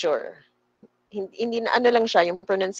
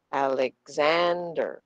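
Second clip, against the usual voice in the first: slow Alexander, long "zand", light "Alex" and "er"; stressed second A; 3 semitones lower, slower than usual. Shown as a demonstration. Teacher recapping for students, slow Alexander stressed on "zand". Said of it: "Ano ang hindi sinestress?".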